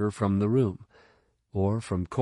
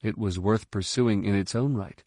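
plain reading voice

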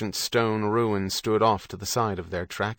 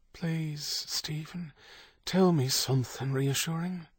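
soft, higher pleading voice; pleading Kate; 7 semitones higher, 6 dB quieter than usual. A narrator's reading. Kate begs Stephen for reassurance, soft high plea. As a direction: plead gently, pitched up.

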